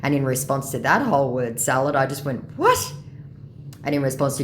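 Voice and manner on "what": high, loud incredulous "what". Retold or imitated. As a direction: burst out in sharp disbelief. Reacting to scammer's word salad.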